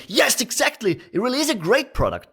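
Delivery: high, gushing sales pitch; over-the-top gushing. Shown as a demonstration. Salesman gushing too eagerly to a prospect. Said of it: "You're not gonna be saying".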